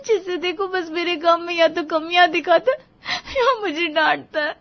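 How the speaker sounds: high-pitched tearful complaint; crying. A narrator's reading. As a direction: sob out the hurt to herself. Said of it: "Anjali को ऐसे रोता हुआ देखकर". (रोते हुए ऊँची आवाज़ में शिकायत)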